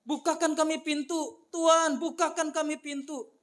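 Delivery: high, pleading cry at the gate; shouting plea. A narrator's reading. Foolish virgins crying at the shut gate, high and pleading. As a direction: call out to be let in. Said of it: "Mereka berteriak-teriak".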